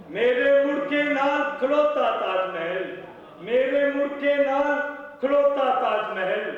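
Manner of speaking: high-pitched declaimed Punjabi verse; poetry recital. Acted. Poet reciting couplets at a mushaira, declaimed recital. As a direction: recite phrase by phrase, repeating lines for the audience.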